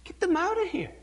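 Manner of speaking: high put-on voice, brushing them off; a hint of a cranky disciple; mock shooing. Preacher mimicking a disciple shooing kids. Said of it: "I'm not Jesus, you know".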